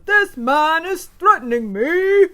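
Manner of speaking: slow, high, loud indignant cry; hammed-up indignant movie line; Trading Places quote. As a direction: play the film line with outrage. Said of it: "in Trading Places".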